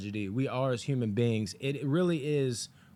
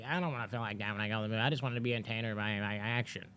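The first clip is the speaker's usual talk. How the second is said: mocking moviegoers; 2 semitones lower, 6 dB quieter, much faster than usual. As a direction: rattle off a grumble, mocking them. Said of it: "I'm mocking the people".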